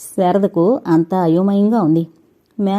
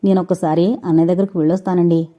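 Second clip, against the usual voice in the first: usual speaking voice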